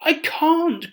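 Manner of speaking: high pleading breathy cry; desperate cry. Acted. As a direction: plead in fear. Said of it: "cried Chang".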